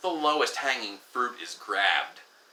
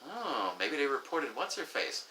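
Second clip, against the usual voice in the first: much softer, sly aside; gossipy murmur; 8 dB quieter than usual. Retold or imitated. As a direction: mutter it like a rumour spreading. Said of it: "people are like".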